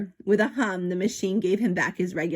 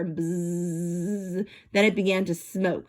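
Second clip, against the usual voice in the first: usual speaking voice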